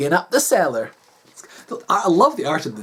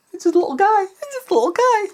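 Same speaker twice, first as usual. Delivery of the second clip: very high, squeaky cooing; cutesy little voice; 13 semitones higher than usual. Pretend. Reader fussing over picture of floof, cutesy. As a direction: coo in a tiny high voice.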